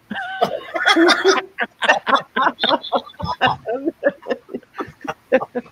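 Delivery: long, high, loud burst of laughter. Real, not acted. Joke teller laughing hard at his own punchline. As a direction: let the laugh roll on after the punchline.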